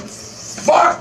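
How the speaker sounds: very high, startled curse; reenacted terrified cry. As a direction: cry out as if jolted awake in terror. Reenacting terror on waking.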